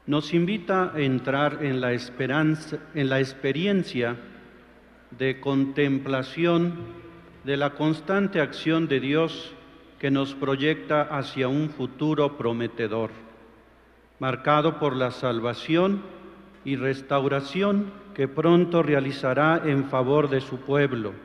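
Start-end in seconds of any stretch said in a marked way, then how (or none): none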